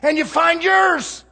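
slow shouted climax; preacher's shout. Acted. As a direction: hammer it home to the congregation. Repeated louder than line 2.

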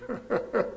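soft, short chuckle. Real, not acted. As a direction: chuckle quietly at your own remark. Laughs after own warning.

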